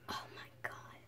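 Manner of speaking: breathless whisper of dismay. Real, not acted. To viewers, a stunned whispered oh my God. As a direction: barely breathe it out. Reacts to failed experiment.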